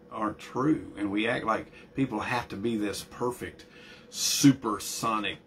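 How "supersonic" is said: slow emphasis. Real, not acted. Drawn-out emphatic word.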